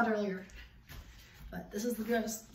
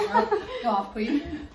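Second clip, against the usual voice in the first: hype cheer; 6 semitones higher, 6 dB louder, slower than usual. Real, not acted. Hyping friend's outfit.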